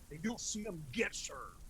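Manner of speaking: warning whisper. Acted. Warning about Kentucky warrants.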